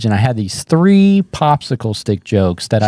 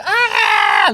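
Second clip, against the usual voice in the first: very high thin cry; mock crowd roar. Comic imitates the audience reacting, mock high shriek. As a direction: imitate a whole crowd erupting, high-pitched. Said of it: "everyone's like".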